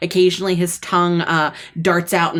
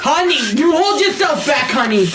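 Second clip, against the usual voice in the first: high excited plea; pleading husband; 9 semitones higher, 3 dB louder than usual. Acted. Snake husband pleads with his wife, high and excited. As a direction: urge her to hold back.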